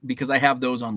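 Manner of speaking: ordinary delivery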